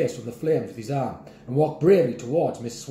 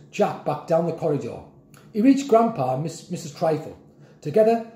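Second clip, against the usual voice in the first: ordinary delivery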